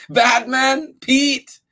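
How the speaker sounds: high, loud calling shout. Acted. Charlie calls a guessed name to the dog, high shout. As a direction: call the dog across the street. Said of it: "shouted Charlie".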